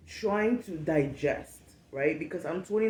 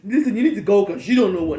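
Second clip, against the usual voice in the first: fast, loud mock dismissal through laughter; mock boss firing; 9 dB louder, faster than usual. Imitating a boss who never fired her, laughing loudly. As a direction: rattle off a boss's firing line, laughing. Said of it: "I've not been said".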